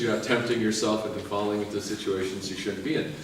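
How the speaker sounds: ordinary delivery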